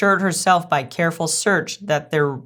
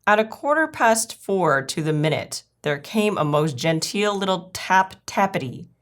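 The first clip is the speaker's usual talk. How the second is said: ordinary delivery, nothing marked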